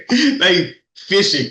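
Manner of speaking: cracking up while he explains; laughing. Real, not acted. To his co-host about the phone calls, laughing.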